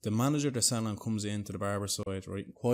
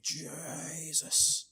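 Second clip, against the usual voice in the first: long drawn-out, high-pitched wonder; high, lingering gasp of wonder; acted awe; 7 semitones higher, 3 dB quieter than usual. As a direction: stretch it out in mock amazement.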